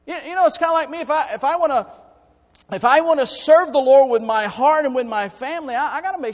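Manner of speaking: high, loud impassioned preaching; preaching at full force. Real, not acted. Pastor to congregation, loud high fervent preaching. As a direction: lift the voice and preach hard.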